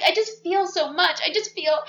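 high, swooning overacted gush; hammed-up melodrama; mock tearful heroine pouring feelings; dramatic heroine. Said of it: "she's so dramatic at this point".

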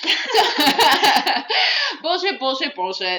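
high, loud laughing repeat; cracking up while repeating it; giggling through the words. Real, not acted. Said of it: "laughing at the show".

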